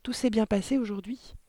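put-on sweet, innocent child's politeness; sugary sweet. A narrator's reading. Daughter asking her father about his day, sugary. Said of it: "fit-elle d'un ton sucré".

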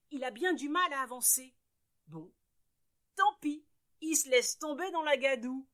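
ordinary delivery

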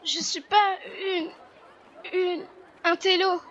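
hesitant protest. Acted. Bullied girl protests to harassers.

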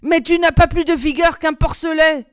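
loud, high, strained scolding; grumbling. A narrator's reading. Girl scolds the weak climber, grumbling with effort. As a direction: chide them while straining under the load. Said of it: "grogna-t-elle avec une mimique de douleur".

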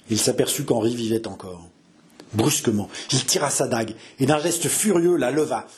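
ordinary talk, nothing marked

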